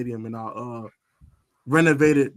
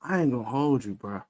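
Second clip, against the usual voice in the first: ordinary delivery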